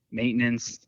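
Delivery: ordinary talk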